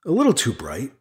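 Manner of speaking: usual speaking voice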